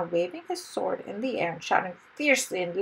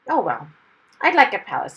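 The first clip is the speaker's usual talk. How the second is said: lifted, louder burst of eagerness; eager acceptance; 10 dB louder than usual. A narrator's reading. Hero accepting the princess's offer of a palace, eager. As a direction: jump at the offer, loudly.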